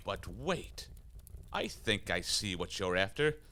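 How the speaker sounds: ordinary talk, nothing marked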